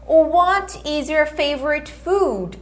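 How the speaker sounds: slow, clear teacher model; slow repeat-after-me model; slow model phrase. Shown as a demonstration. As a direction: say it slowly for them to repeat. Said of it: "Repete comigo".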